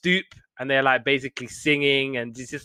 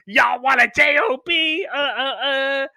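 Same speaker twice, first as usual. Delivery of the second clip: high, loud mock shout; over-the-top commercial showman; wild ad pitchman; 12 semitones higher, 3 dB louder than usual. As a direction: holler the slogan, then add fake grunted laughs. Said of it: "like he's Super Freak or someone".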